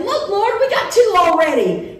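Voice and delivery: high and loud, proudly showing off; eager disciple. Retold or imitated. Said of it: "The disciples say".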